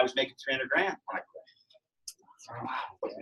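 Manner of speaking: soft, slow whisper; slow confiding whisper; whispered aside. Real, not acted. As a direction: let the figure slip out under your breath. Confessing his big salary.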